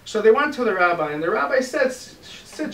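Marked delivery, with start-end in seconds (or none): 1.9-2.7 breathy whispered start. Acted. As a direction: breathe the word out. Rabbi begins instruction.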